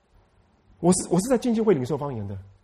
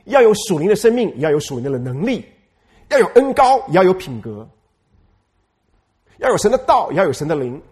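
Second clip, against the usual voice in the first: fiery preaching; 6 dB louder than usual. Real, not acted. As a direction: preach it out at full force. Preaching call and response build. (高声激昂地讲道)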